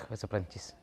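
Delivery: usual speaking voice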